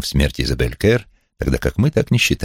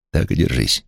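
ordinary delivery, nothing marked

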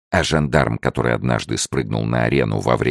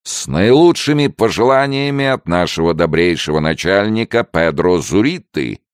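high, tipsy ceremonial announcement; drunken mumbling; 6 semitones higher, 4 dB louder than usual. A narrator's reading. Drunk gendarme hands Henry a bottle, mumbling solemnly. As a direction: present it grandly with a drunken tongue. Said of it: "пробормотал жандарм".

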